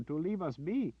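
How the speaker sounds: high, pleading voice; hope for a bloodless rescue; earnest villager. Acted. Villager explains their hope.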